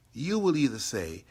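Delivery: ordinary delivery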